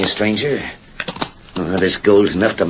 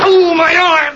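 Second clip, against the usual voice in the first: sudden high pained yelp; cry of pain; 3 dB louder, slower than usual. Acted. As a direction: gasp it out as the arm is hit. Shot in the arm.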